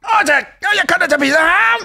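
mock her shrieking complaint; mocking sister.

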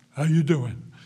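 a loud, hearty hello; routine loud greeting. Retold or imitated. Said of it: "I go".

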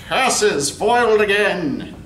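hammy stage villain, slow and laughing; mustache twirling villain. Acted. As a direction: growl your defeat like a cartoon villain. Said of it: "this dastardly villain with twirling mustaches".

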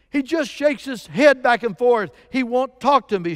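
high, loud confused shout; retold shout. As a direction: shout it at the room, hurt and confused. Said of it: "she shouts".

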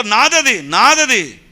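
loud, insistent shout of ownership; loud claiming shout. Acted. Church member claiming a lottery win, loud shout. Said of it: "బిగ్గరగా చెప్పడంలో".

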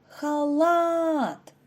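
халат with a long, high "лат"; халат, final syllable held out; stressed second syllable. Shown as a demonstration. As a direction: stretch and lift the last syllable of халат. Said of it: "Наголос падає на другий склад".

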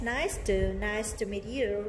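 nice to run together, the ce as an s; teacher showing natural linking in nice to meet you; linked nice to. Shown as a demonstration. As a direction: link nice into to smoothly. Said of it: "xe sẽ được đọc thành âm S".

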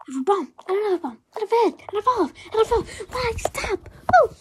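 fast, high panic; mock panic, pitch climbing; panicked babble. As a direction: rattle off the bombs in rising panic. Panicking while juggling bombs.

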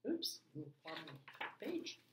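ordinary delivery

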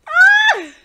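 sudden high yelp; a loud startled cry; startled shout. Acted. Startled character yelps at sudden praise, loud.

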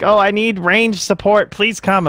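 high, slow whiny plea; whiny teammate. Retold or imitated. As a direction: beg like a helpless teammate. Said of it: "someone's like".

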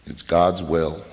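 ordinary delivery

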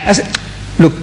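ordinary talk

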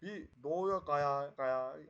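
slow mock eastern Turkish accent. Shown as a demonstration. Streamer showing viewers how the accent slips, playful eastern Turkish accent. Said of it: "benim şive harbi söylüyorum çok garip çalışıyor".

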